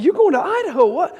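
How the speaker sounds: high, disbelieving outburst; retold astonishment. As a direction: act stunned at the news. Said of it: "I said".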